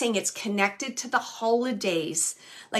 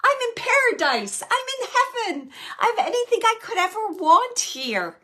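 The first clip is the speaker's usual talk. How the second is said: joyful; 11 semitones higher, 6 dB louder than usual. Retold or imitated. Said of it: "And he's laughing".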